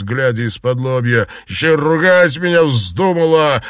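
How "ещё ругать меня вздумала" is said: loud, indignant retort; furious outburst, pitched up; angry shout. A narrator's reading. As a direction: snap back with raised voice. Enraged husband keeps shouting.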